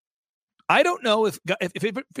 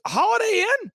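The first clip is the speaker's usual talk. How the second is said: much higher mock disbelief; incredulous. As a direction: echo it back in shocked disbelief. Reporter's incredulous reply.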